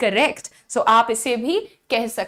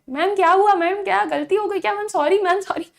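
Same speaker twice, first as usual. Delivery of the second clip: higher, pleading student voice; mock student whine; 6 semitones higher than usual. Students pretending sorry to scolding teacher, higher whine. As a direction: play the kids apologising without stopping.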